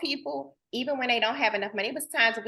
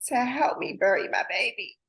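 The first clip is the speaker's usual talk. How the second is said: ordinary talk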